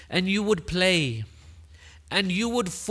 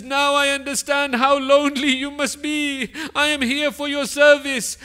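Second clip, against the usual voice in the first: put-on raised, crying voice of an old man moved to tears; louder, higher, weeping words of devotion; tearful plea; 8 semitones higher, 4 dB louder than usual. A narrator's reading. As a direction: let the voice break with grief as he speaks. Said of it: "Habib begins to cry and says".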